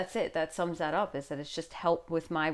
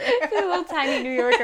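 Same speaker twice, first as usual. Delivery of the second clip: laughing; 10 semitones higher, 6 dB louder than usual. Real, not acted. Cooing at son's accent.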